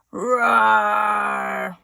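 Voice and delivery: long, loud cartoon roar; angry roar. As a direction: roar like a furious unicorn. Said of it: "What about an angry unicorn?".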